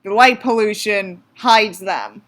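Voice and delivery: ordinary talk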